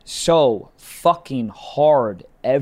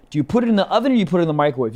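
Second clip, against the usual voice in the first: rushed; much faster than usual. Acted. Acting quick rushed question.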